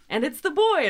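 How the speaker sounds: announcer call. Retold or imitated. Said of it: "we got".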